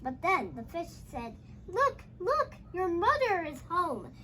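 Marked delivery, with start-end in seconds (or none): none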